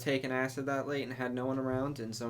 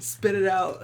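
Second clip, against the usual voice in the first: mock yell; 7 semitones higher, 7 dB louder than usual. Repeating the order laughing.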